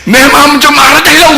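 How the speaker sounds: high-pitched, insistent cry to be understood; yelled plea. Acted. Wife yelling at husband out of love, acted pleading shout. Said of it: "막 소리를 질러가면서".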